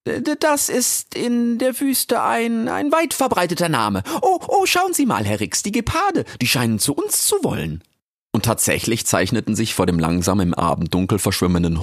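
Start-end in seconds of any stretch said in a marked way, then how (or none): 0.1-7.8 very high, stammering; flustered Frau Jaki. Acted. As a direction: caught off guard, stumble over the words. (verlegene Frau Jaki, sehr hoch und stotternd)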